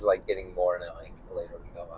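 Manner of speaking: creaky vocal fry, fast and low. Real, not acted. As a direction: let it crackle into vocal fry. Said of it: "vocal fry everything I say".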